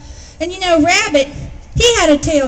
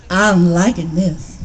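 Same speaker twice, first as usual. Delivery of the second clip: Possum; 8 semitones lower, slower than usual. A narrator's reading. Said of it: "said Possum".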